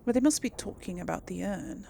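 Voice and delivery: ordinary talk, nothing marked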